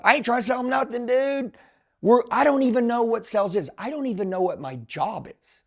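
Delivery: high-pitched, incredulous protest; animated disbelief. Retold or imitated. Recalls clueless first-day self.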